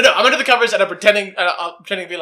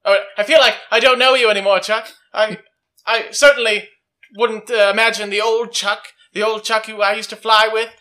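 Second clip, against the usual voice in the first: acted indignation, raised in pitch, building louder; mounting accusation; 4 semitones higher, 6 dB louder than usual. Jack accuses his old flying friend Chuck, mounting indignation.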